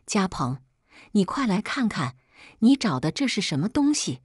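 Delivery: ordinary delivery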